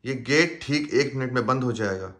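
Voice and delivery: rushed. Acted. Rushed warning to passenger.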